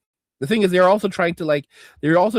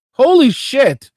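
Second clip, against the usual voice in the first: loud, high, stretched-out disbelief; surprised shout; 8 semitones higher, 10 dB louder, slower than usual. Real, not acted. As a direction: blurt it out in shock. Reacting to a surprise donation.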